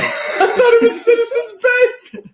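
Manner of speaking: high, drawn-out mocking echo through laughter; laughing mockery. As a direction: parrot it back in a squeal, cracking up. Mocking friend's claim amid laughter.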